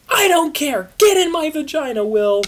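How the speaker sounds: high, loud furious shout; angry yell. Acted. Christina yells for Will to come out. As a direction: bellow at him to come out.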